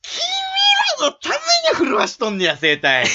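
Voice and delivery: high-pitched theatrical cry; catchphrase tone. Acted. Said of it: "結論もそのトーン". (甲高く芝居がかった絶叫)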